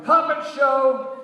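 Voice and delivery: high, loud sing-song shout, slow. A narrator's reading. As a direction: announce it like a carnival barker.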